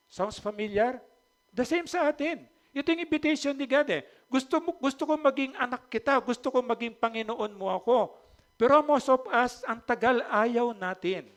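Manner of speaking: high, loud preaching voice; fervent preaching. Acted. Pastor urging listeners to answer God's invitation, fervent. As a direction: drive it home to the congregation.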